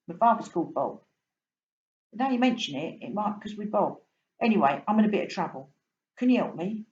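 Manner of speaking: a cockney fish pleading for a hand; London fish begging the boy for help; London fish asking for help. Acted. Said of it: "You can tell I'm from London".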